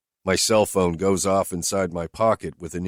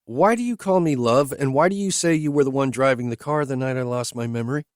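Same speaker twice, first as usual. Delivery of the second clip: higher, lightly voiced woman asking bluntly; higher woman's voice, questioning her driver; Whitney's voice; 8 semitones higher, 3 dB louder than usual. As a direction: lift into her voice, direct and searching.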